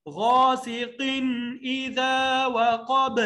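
slow, drawn-out tajweed chant; teacher reciting verse slowly; Quranic recitation. Shown as a demonstration. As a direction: recite it long and measured. Recitation demo.

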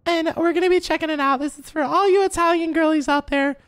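influencer intro voice. Acted. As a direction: open the video like a beauty vlogger. Films a makeup review video.

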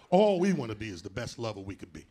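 ordinary talk, nothing marked

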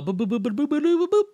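toy-like boop noises climbing up; playful boop sounds. Real, not acted. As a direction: bop out little boops while you wait. Playful filler noise while waiting.

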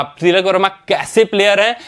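ordinary talk

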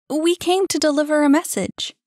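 voiced character. A narrator's reading. Young character voiced by narrator.

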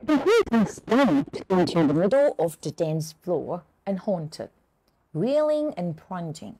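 very slow, phrase-by-phrase classroom reading; measured, careful English narration; story read-aloud. Acted. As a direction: read the story to learners slowly, with pauses between phrases. Teacher reads the story aloud.

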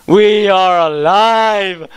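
slow, high, loud proclamation; triumphant cheer. Real, not acted. Player safe home after the cave, triumphant cheer. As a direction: draw out every word in triumph.

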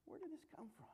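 hushed, breathy wondering; bewildered whisper. Retold or imitated. Couple wondering aloud where the fight came from, whispering. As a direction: whisper it in puzzled disbelief. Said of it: "look around and go".

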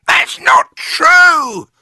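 very high, drawn-out shriek; distressed screechy wife; Mrs Twit crying out. A narrator's reading. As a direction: screech a loud, slow denial. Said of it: "cried Missus Twit".

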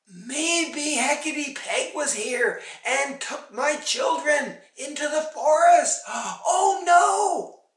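high, loud, building alarm; mother's dawning panic; alarmed realisation. A narrator's reading. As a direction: work it out in horror, ending in a cry. Mother realises children were taken.

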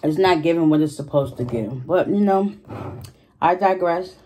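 usual speaking voice

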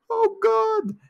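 squeaky mock-horror yelp; mock terrified. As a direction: play the spooked drawing, yelping in fright. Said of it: "They're like".

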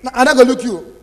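ordinary talk, nothing marked

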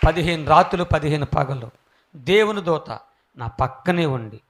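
usual speaking voice

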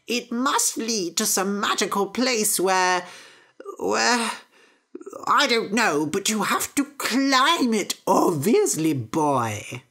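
put-on slow, high, wavering old mother; dotty old mother. Mother sending Jack to plant beans, high and dotty. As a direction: flutter and wander off mid-thought.

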